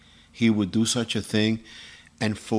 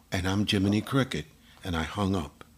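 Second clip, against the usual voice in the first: usual speaking voice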